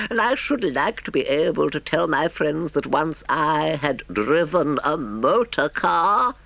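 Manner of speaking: high, pleading put-on voice; humble washerwoman. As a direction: wheedle politely as a meek washerwoman.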